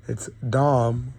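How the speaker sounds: "Dom" said with an open "ah"; "Dom", short and open; Dame as Dom. Shown as a demonstration. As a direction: say "Dom" like the start of "damn". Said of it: "That's how you pronounce it".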